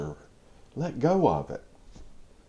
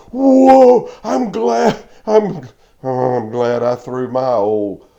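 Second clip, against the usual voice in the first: put-on high, loud, gleeful child's voice; thrilled little girl; 4 semitones higher, 10 dB louder, slower than usual. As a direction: bubble over with joy. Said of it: "all of a sudden she's like".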